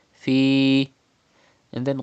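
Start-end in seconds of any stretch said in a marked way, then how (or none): 0.1-0.9 Fi with the vowel held two counts; two-beat madda. Shown as a demonstration. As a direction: hold the long i of Fi for two beats. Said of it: "stretch up to two harkat like this".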